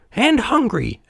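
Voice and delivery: put-on high, childlike puppet voice; small high add-on; Pinocchio. A narrator's reading. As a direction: pipe up cheekily. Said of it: "added Pinocchio".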